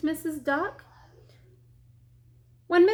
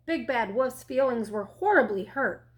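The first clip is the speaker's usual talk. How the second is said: usual speaking voice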